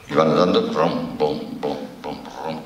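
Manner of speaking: slow booming rom bom chant; imitated marching drumbeat. [name] mimics migrants marching on the fence, slow drumbeat chant. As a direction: beat out the march like war drums.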